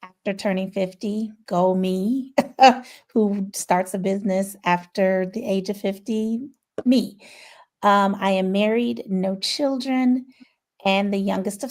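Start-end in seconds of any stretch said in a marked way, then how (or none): none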